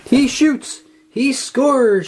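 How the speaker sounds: high excited sports call; excited shout. A narrator's reading. SpongeBob cheering himself on, shouting. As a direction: call it like a hockey announcer. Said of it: "shouted SpongeBob".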